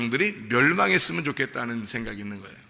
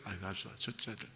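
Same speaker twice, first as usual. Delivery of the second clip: much softer, throwaway mock sigh of giving up; 5 semitones lower, 14 dB quieter, faster than usual. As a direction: brush it off under your breath. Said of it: "그럼 여러분 생각".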